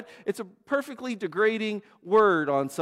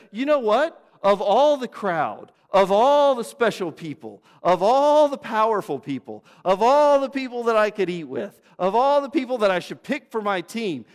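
Jesus proclaiming; 6 semitones higher, 8 dB louder than usual. Acted. Jesus singles out Zacchaeus from the crowd, loud and rising. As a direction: preach it like a rising list.

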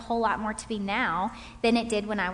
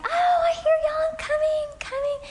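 high sing-song mom calling from afar; 4 dB louder than usual. Retold or imitated. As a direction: call out like a cheerful mother.

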